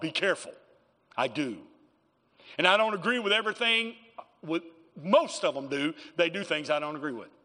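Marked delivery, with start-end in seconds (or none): none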